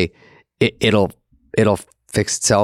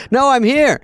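indignant protest; 13 semitones higher than usual. Retold or imitated. Arriving at midnight party.

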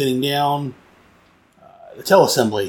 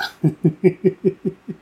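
higher-pitched chuckling run; amused laugh; 5 semitones higher than usual. Real, not acted. Reviewer amused by the spy's acting quip, chuckling. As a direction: chuckle in a string of short bursts.

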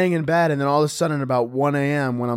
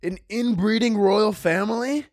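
high-pitched feigned disbelief; mock shock; 8 semitones higher, slower than usual. Public reacting to royal family news, mock shocked. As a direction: act scandalized, voice leaping up. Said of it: "everybody was like".

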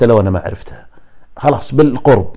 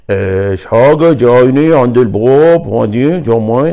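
slow, drawn-out Bahraini intonation; louder, slowed imitation of Bahraini tone; Bahraini accent; 6 dB louder, slower than usual. Shown as a demonstration. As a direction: demonstrate a Bahraini lilt, stretched out. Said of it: "هذه مثلًا بحرينيه كذا تعطي هذا التون".

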